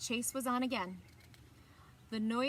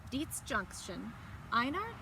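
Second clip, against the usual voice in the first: ordinary delivery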